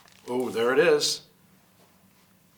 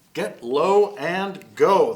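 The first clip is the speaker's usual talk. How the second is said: slow, emphatic; 5 dB louder, much slower than usual. Shown as a demonstration. Reads the book's key phrase.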